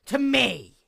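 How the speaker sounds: high, loud cry of vindication; indignant outburst. Acted. Gloating to her sister with a loud triumphant cry. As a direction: throw the words out in triumph. Outraged that Chrysalis prayed to her.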